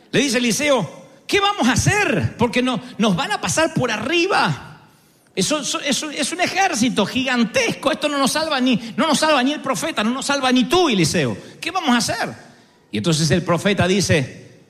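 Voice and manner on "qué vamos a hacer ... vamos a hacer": high, panicky servant crying out; panicked servant. Acted. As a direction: plead in alarm like a frightened servant. Servant panics before army. (criado asustado, voz aguda y alarmada)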